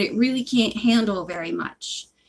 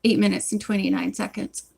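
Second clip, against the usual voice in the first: plain reading voice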